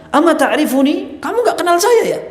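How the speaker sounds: scoffing arrogance. Retold or imitated. Strutting official sneering at Malik bin Dinar, laughing arrogance. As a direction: laugh off the adviser, proud and mocking. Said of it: "dikasih masukan kayak gitu bilang".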